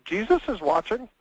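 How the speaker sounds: a voice piercing the dark, high and eerie; high-pitched voice. Retold or imitated. Burglar hears a parrot in the dark, high-pitched voice. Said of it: "a real high-pitched voice".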